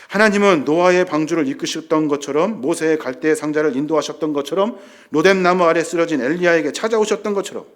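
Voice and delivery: loud, mounting sermon list; impassioned pulpit delivery; forceful preaching. Real, not acted. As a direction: pile up each phrase with rising force.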